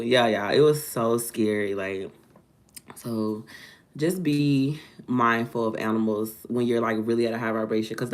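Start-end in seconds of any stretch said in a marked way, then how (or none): none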